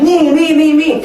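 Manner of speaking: high, loud excited pleading; eager kids volunteering. Acted. Teacher imitating kids who want to be it, eager high cries.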